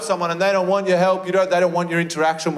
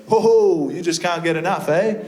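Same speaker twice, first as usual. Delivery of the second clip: hearty blokey greeting; 3 semitones higher than usual. Retold or imitated. Petrol station bloke teasing a returning customer, hammed-up hearty. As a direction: boom it out like a jolly servo attendant. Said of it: "he goes".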